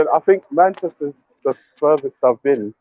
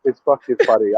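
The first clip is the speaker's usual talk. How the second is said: normal talking voice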